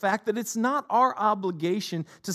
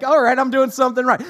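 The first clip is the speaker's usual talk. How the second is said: louder, quicker cheeky gloat; a hammed-up, pleased-with-yourself gloat at the mother-in-law's warning; mock triumphant; 6 semitones higher, 11 dB louder, faster than usual. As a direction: crow over it like you won. Mock reaction to mother-in-law.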